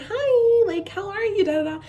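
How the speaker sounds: high, loud cheery customer hello; bubbly greeting. Retold or imitated. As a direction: sing out an over-friendly greeting, mimicking your old self. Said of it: "I used to be very".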